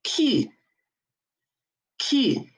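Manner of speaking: "Q" as "kü", tight rounded lips; French letter Q. Shown as a demonstration. Teacher modelling for class, French "kü" for "Q". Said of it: "the Q comes out as".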